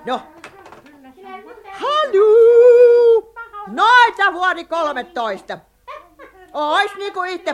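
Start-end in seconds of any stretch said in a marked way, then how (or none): 2.0-3.3 loud phone greeting. Acted. Answering the phone loudly.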